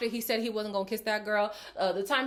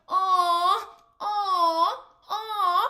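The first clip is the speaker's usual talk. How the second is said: swooning aww at high pitch, a little louder; mocking aww; 9 semitones higher, 7 dB louder, much slower than usual. Recapping the girls' swooning reaction, mocking aww. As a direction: coo over it like the girls did. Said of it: "So all the girls".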